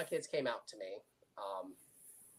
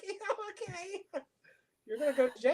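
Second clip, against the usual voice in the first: sarcastic; 3 dB quieter than usual. Retold or imitated. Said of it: "I'm like".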